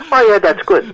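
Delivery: put-on high, old Yiddish-accented mother voice; imitating his mother. Son imitating his late mother reacting to the check, high Yiddish-accented voice. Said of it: "I happen to do my mother quite well".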